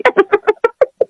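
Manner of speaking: high, chuckling laugh; real high-pitched giggle; amused laugh. Real, not acted. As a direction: laugh at your own joke. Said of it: "Lucu ya".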